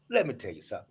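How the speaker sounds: hushed dad voice; low fatherly aside. Retold or imitated. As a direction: lean in and tell the boy quietly. Father lowering voice to son.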